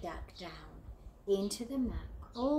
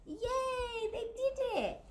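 cheering; slower than usual. Acted. Triumph ending.

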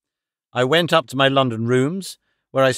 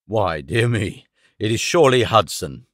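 tone of surprise; 4 semitones lower than usual. A narrator's reading. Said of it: "in a tone of surprise".

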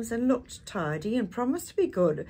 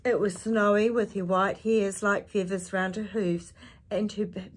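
ordinary delivery, nothing marked

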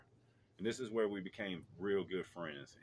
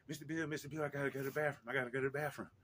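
high, urgent child impression; pitched-up little boy voice; imitated little boy; 6 semitones higher, much faster than usual. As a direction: plead like a small kid who needs the toilet. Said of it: "he goes".

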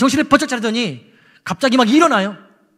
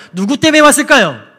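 loud rhetorical question; 6 semitones higher, 9 dB louder than usual. Real, not acted. Preacher asks the congregation, loud and higher. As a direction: raise the voice, pitched up.

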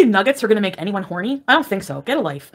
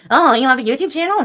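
higher-pitched put-on question; mock interest; 4 semitones higher, 3 dB louder than usual. Retelling meeting a small YouTuber, mock interest. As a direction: imitate someone's put-on curiosity.

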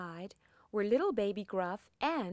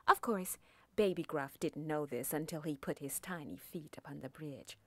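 plain reading voice

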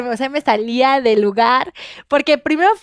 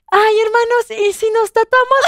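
sister's eager suggestion, pitched higher; imitating her sister; 8 semitones higher than usual. Sister proposing tattoos to her siblings, playfully higher. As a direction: lightly play the excited sister pitching an idea. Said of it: "Y entonces mi hermana".

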